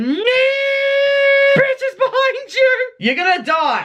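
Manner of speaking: high laughing shout as a panicking car monitor; mock alarm shout. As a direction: yell the warning like a frantic sensor. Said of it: "My monitor in my car's going".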